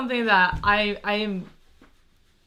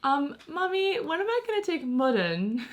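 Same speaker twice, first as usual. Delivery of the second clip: put-on higher, light child voice; little daughter asking; 7 semitones higher than usual. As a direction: ask like a small girl wanting a class. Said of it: "and she'll be like".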